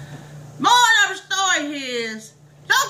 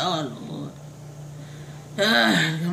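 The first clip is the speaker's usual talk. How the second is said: dreading groan; 10 semitones lower, 5 dB quieter, slower than usual. Acted. Dreading seeing the neighbor.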